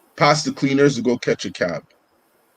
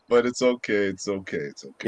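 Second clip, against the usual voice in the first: fading to a murmur; 3 semitones lower, 7 dB quieter than usual. Real, not acted. Letting it go, trailing away.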